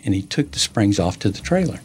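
normal talking voice

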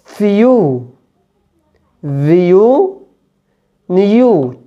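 فيو said as "fyoo" with a clear "y" before the "oo"; "yoo" sound. Shown as a demonstration. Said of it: "بنطق معاها صوت يا". (أو مع صوت يا واضح)

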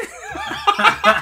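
high loud burst of laughter. Real, not acted. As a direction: break into helpless laughter. Cracking up at own joke.

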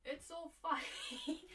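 slow, breathy laugh through the words. Real, not acted. Delighted by her new headphones, laughing. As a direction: giggle as you say it.

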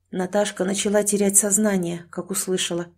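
plain reading voice

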